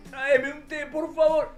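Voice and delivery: high-pitched pleading invalid; needy patient. Acted. Host mimics himself sick asking his wife, high and pleading. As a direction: beg like a helpless flu patient, hammed up. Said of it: "yo sé lo que soy cuando me engripo".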